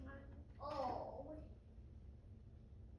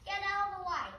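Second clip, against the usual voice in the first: ordinary delivery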